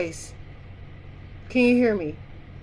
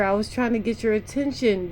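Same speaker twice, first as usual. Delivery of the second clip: usual speaking voice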